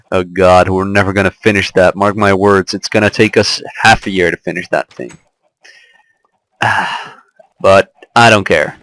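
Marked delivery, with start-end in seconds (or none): none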